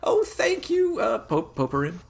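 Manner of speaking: high country mother, overcome with thanks; folksy farm lady, haltingly touched; flustered farm mom. Acted. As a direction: flutter gratefully in a high falsetto. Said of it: "And she's like".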